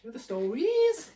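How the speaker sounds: high, loud witch voice. Retold or imitated. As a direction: screech it like a witch. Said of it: "You sound like a witch".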